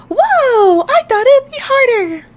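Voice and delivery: high, wide-eyed surprise; amazed. Acted. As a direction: squeal it in a very high character voice. Carrie amazed at the machine.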